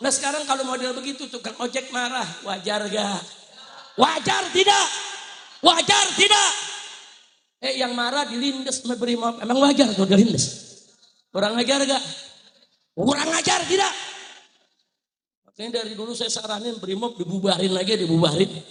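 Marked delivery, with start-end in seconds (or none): 4.0-6.5 high, loud crowd challenge; real pulpit yell; rallying shout. Real, not acted. Repeats to rouse crowd. 12.9-13.9 high, rising call to the crowd; high pulpit cry; rallying challenge. Real, not acted. Repeats higher to rouse crowd.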